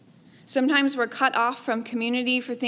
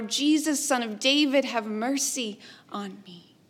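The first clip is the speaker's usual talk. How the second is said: usual speaking voice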